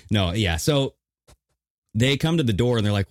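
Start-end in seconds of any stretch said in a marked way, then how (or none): none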